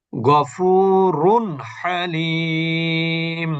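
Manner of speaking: very slow chanted গফুরুন হালিম; clear nun. Shown as a demonstration. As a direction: stretch the long vowels, clean n at the end of গফুরুন. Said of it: "নুনের উচ্চারণ স্পষ্ট". (গফুরুন হালিম ধীর তিলাওয়াতে, নুন স্পষ্ট)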